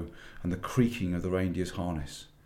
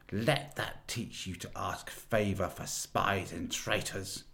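cold witch; 4 dB quieter, slower than usual. Acted. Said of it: "said the witch".

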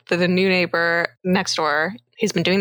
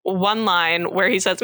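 ordinary talk

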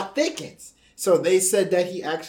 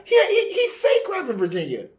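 laughing mock outrage; 12 semitones higher than usual. Mocking shout, laughing.

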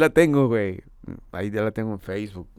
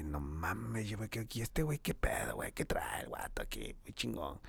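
quietly whispering an amazed aside; whispered aside; 15 dB quieter than usual. Retold or imitated. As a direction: mutter it under your breath, amazed. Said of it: "Me dice". (susurrando asombrado, casi sin voz)

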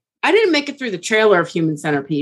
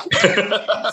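witch cackle; 3 semitones lower than usual. Shown as a demonstration. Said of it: "the perfect cackle".

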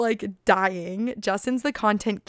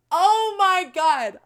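high, drawn-out oh my God; slow, high storyteller's outburst; dramatic exclamation; 9 semitones higher, 4 dB louder, much slower than usual. Real, not acted. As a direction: stretch it out, pitched way up.

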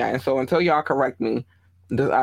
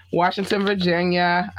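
normal talking voice

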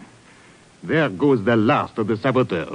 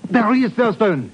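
urgent shout; 10 semitones higher than usual. Acted. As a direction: call out sharply in alarm. Spotting the fugitive at window.

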